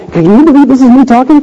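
high-pitched, overwhelmed disbelief, acted out; retold tearful amazement. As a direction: imitate someone marveling through tears. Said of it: "She starts crying".

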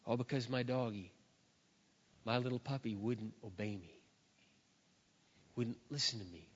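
low, hushed and sorrowful; sombre, grieving. Real, not acted. Preacher recalling his dead puppy to the children, sombre and grieving. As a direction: let it sink softly, with pauses.

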